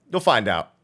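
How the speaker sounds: ordinary talk, nothing marked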